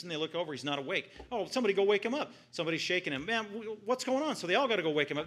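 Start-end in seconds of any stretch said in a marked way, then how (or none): 1.3-2.3 fast, loud and high, urgent; panicked urging. Retold or imitated. Disciples panic in the storm. 3.2-4.3 confused. Retold or imitated. Woken Jesus voiced, confused.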